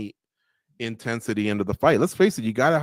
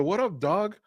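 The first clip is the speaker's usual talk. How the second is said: mock casual greeting; 8 semitones higher, 4 dB quieter than usual. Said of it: "Us as humans usually go".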